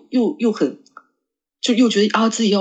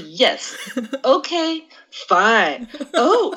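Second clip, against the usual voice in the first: slow, drawn-out canned English answers with a laugh; mock clueless replies; 3 semitones higher, much slower than usual. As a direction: play the lost foreigner nodding along. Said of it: "人家说什么都是". (慢吞吞地敷衍回答，带着笑)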